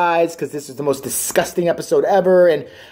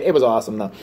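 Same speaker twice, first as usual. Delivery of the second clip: ordinary talk, nothing marked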